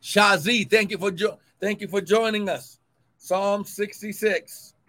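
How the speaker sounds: usual speaking voice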